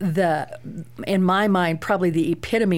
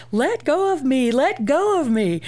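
put-on high, drawn-out child's yell; imitated screaming; 8 semitones higher than usual. Mother mimics her daughter pinned on the floor, screaming. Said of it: "And she's screaming".

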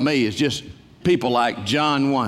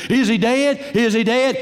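1 panicked; 9 semitones higher than usual. Retold or imitated. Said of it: "and they said".

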